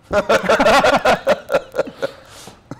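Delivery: long loud high laugh; a hearty laugh at his own pitch. Real, not acted. Laughs at good guy Russian.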